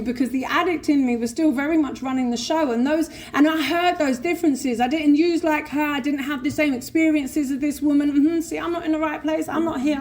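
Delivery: ordinary delivery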